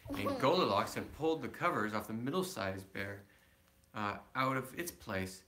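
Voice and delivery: ordinary delivery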